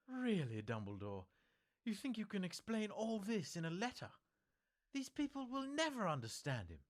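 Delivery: put-on high, loud, outraged old witch; indignant outburst. A narrator's reading. McGonagall rails at Dumbledore against the Dursleys, loud and outraged. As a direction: protest with full indignation.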